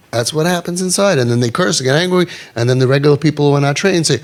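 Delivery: usual speaking voice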